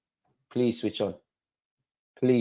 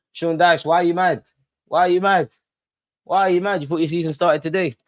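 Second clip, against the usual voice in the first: higher, louder mocking question; loud taunt; 6 semitones higher, 4 dB louder than usual. Real, not acted. Fan taunts Sean Dyche about his anger, loud and high. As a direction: rub it in, over and over.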